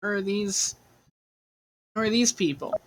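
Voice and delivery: put-on high curious child; little kid voice. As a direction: ask like an eager kid. Child voice asking about strangers.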